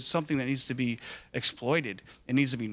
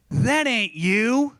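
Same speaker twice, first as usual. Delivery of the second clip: high, loud, drawn-out accusation; imitated child, high and loud; little girl; 8 semitones higher, 6 dB louder, much slower than usual. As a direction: mimic a kid calling him out slowly. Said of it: "this little girl".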